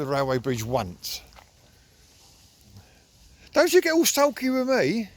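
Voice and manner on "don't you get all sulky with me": high, loud mock telling-off; mock scolding. Real, not acted. Owner playfully scolds his sulking dog on the walk, mock telling-off.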